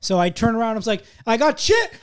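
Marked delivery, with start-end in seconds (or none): 1.3-1.9 sudden high yell of alarm; retold shout. Coworker shouting a warning at the busboy, high alarmed yell. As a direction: yell it as the chili hits.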